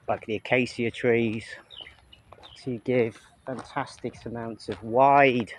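ordinary talk, nothing marked

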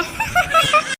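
high burst of giggles; short high giggle; laughing. Acted. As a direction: crack up at the joke. Laughing at the microphone joke.

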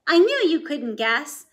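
proud Jack. A narrator's reading. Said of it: "Jack proud".

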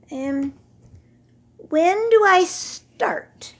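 high, slow, hesitant camel; careful question. Acted. Old camel asking the sand voices, slow and wary. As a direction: ask as if afraid of the answer. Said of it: "he asked carefully".

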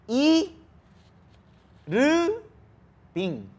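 "I, Ru, Bin" in separate syllables, first two pitched high; Korean syllable-by-syllable pronunciation. Shown as a demonstration. Tutor showing friend his Korean name, syllable by syllable. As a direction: spell out "I... Ru... Bin" with clear pauses, last one dropping. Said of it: "cómo se pronuncia en coreano".

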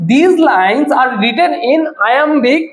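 very slow, measured word by word; spaced-out teacher dictation. Shown as a demonstration. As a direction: dictate it for students writing notes. Teacher dictating answer to students.

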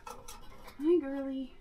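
quiet, high coo to a skittish rabbit; hushed reassurance to the doe; soft tender coaxing. Real, not acted. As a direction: soothe the mama rabbit gently. Talking softly to nervous mother rabbit.

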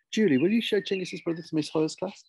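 high, bright-pitched teacherly request; teacher calling on pupil. Acted. Teacher asking Julie to take the brother away, high-voiced request. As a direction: raise the pitch and send a pupil off on an errand.

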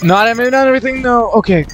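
high, rushed yelp of alarm; sudden squeaky alarm. Real, not acted. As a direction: blurt it out fast in a high panicky voice. Backtracks as game suddenly turns bad.

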